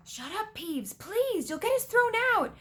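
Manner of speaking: high, anxious begging; urgent plea. A narrator's reading. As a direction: plead urgently, pitched well up. Acted plea to troublemaking poltergeist.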